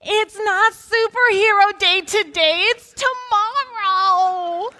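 high, drawn-out mock wail; hammed-up tearful whine; crying child. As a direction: imitate a kid bawling, dragging out the last words. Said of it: "She starts crying".

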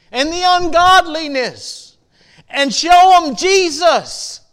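a revival preacher at full throttle, drawing out each phrase; preaching at full force. Acted. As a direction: preach it out, pleading and rising. Sermon builds to full preaching.

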